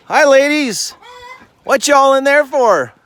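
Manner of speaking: high, loud greeting to chickens; pet-talk greeting. Real, not acted. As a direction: sing out to the ladies. Greeting hens in the coop.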